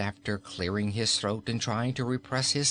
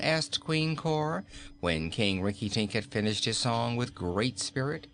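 usual speaking voice

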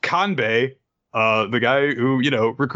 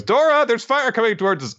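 fast higher warning; 12 semitones higher, faster than usual. Retold or imitated. Urgent warning.

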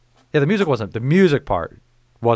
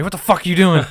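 mock angry snap; 7 semitones higher, 5 dB louder than usual. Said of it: "turn around and say".

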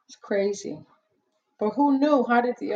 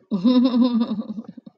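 laughing burst; 4 semitones higher, 5 dB louder than usual. Real, not acted. Laughs at own joke.

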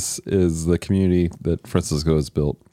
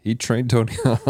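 laughing; 6 semitones higher than usual. Acted. Repeats joke while laughing.

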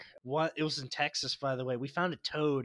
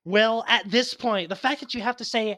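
raised, higher arguing voice; heated rebuttal; 4 semitones higher, 5 dB louder than usual. Real, not acted. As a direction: argue back forcefully. Arguing back at disagreement.

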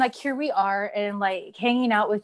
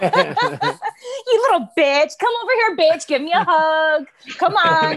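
laughing mock affection; 7 semitones higher, 5 dB louder, slower than usual. Playful mock love for wrestling.

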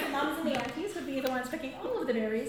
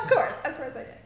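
loud laughing, mock smug; laughing sarcastic; 3 semitones higher, 9 dB louder than usual. Repeats quip laughing loud.

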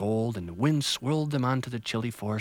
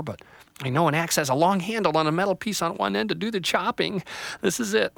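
put-on high, hurt young tree voice; a small tree on the verge of tears; upset little tree; 7 semitones higher than usual. Little Tree left behind, high and dismayed.

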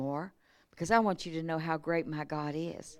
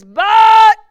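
one huge shouted word; shouted but; 14 semitones higher, 21 dB louder than usual. Real, not acted. Pastor exploding on one word before the promise. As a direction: blast the word out.

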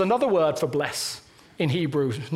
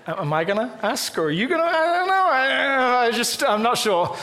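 nervous, fast, higher-pitched stumbling; hesitant dithering; 5 semitones higher than usual. Retold or imitated. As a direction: fumble for words like someone afraid to ask first. Acting out a hesitant awkward moment.

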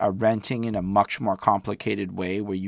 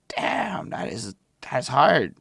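impressed exclaim; 6 semitones higher, slower than usual. Retold or imitated. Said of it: "I'm just like".

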